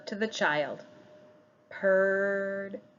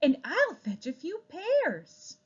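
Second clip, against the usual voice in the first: wife voice; 8 semitones higher than usual. Acted. Wife speaks.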